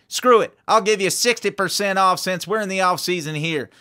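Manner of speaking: hard-sell fireworks store ad; energetic, emphatic small-town ad read; sales pitch. Acted. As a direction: rattle off the deals like a local commercial pitchman.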